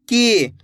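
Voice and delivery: की with the ee held long; drawn-out vowel. Shown as a demonstration. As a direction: stretch the ee of की. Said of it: "खींच खींच के किया जाता है".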